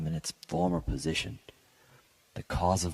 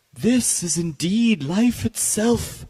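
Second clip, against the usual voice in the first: high, slow, much louder outcry of awe; loud awestruck cry; 8 dB louder, slower than usual. A narrator's reading. Painter crying out before his finished portrait, a loud high awestruck cry. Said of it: "crying with a loud voice".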